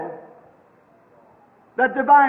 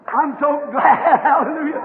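rejoice and let a real laugh through; joyful laughter. Real, not acted. Preacher glad he was found, real joyful laughing.

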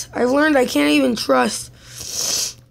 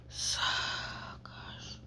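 ordinary delivery

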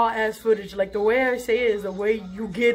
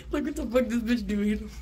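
laughing through words; 5 dB quieter than usual. Real, not acted. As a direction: talk softly while still laughing. Laughing at dog staring.

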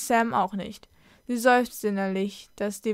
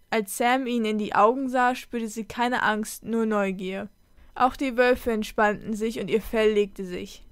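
plain reading voice

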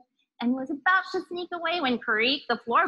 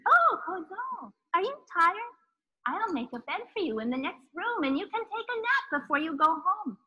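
put-on sweet old woman; 6 semitones higher than usual. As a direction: lure the boy with false sweetness. Witch sweetly offers a bed.

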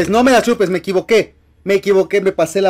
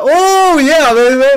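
high, loud victory cheer; triumphant whoop; 8 semitones higher, 7 dB louder, much slower than usual. Real, not acted. Gamer cheering at grabbing the item, loud whoop. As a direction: celebrate the grab at full voice. Scores item while chased in game.

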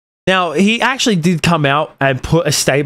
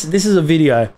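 normal talking voice

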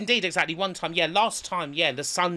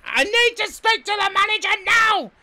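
very high, loud mock demand; imitated shouting; 6 dB louder than usual. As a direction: screech it like a furious hotel guest. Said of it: "Fucking shouting the place".

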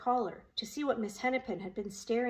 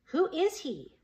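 high, raised question; urgent call, pitched up; calling after someone; 6 semitones higher, slower than usual. A narrator's reading. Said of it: "Roy called after her".

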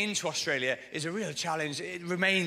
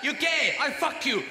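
hammed-up road-rage yell at another cabbie; furious taxi driver shouting; 8 semitones higher than usual. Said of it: "started shouting at this other taxi driver".

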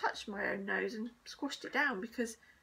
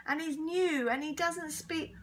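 higher, slower pleading; urgent protest; 6 semitones higher, 4 dB louder than usual. A narrator's reading. Pupil explains to teacher, protesting. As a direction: plead the new boy's case.

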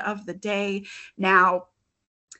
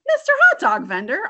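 high loud exclaiming greeting; 10 semitones higher, 9 dB louder, faster than usual. Acted. Amu greeting the vendor at her door, exclaiming. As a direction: call out the name in delight. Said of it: "Amu exclaimed".

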